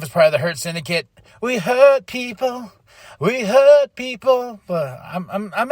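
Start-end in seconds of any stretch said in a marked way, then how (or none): 1.4-2.7 mock tough catchphrase. Quoting the Hurt Syndicate catchphrase. 3.2-4.6 mock tough catchphrase. Repeats catchphrase louder.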